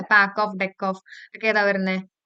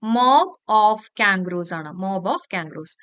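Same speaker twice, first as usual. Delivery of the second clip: slow, drawn-out dictation with a falling end; dictating slowly; 3 semitones lower, much slower than usual. Shown as a demonstration. Teacher dictating the answer to pupils, very slow and measured. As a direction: spell it out word by word for the class.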